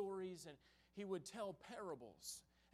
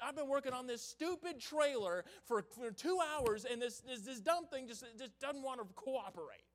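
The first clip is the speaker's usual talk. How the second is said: louder, higher exasperated griping; mock-furious grumbling at a broken project; frustrated rant; 7 semitones higher, 6 dB louder than usual. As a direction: vent at the stubborn trailer. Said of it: "I start getting in my flesh".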